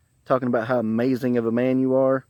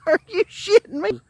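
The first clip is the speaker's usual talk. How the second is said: high, squeaky question through laughter; laughing disbelief. Retold or imitated. Storyteller hearing his coworker's crude poem, laughing disbelief. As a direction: barely get it out for laughing.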